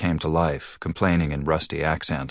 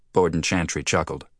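plain reading voice

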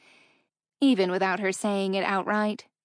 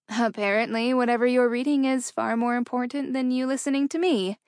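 deadpan; 4 semitones higher, 3 dB louder than usual. A narrator's reading. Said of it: "she expressed in a deadpan voice".

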